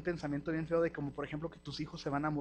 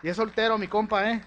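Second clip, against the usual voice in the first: louder, higher playful announcement; teasing hype; 4 semitones higher, 8 dB louder than usual. Real, not acted. Pastor teasing the congregation that Carlos is single, playful loud hype. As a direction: sell him to the crowd with a grin.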